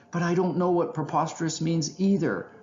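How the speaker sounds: high, mildly whiny complaint; whining. A narrator's reading. Raccoon complaining to his friend, whining. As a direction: whine it like a puzzled child. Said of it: "Rodney whined".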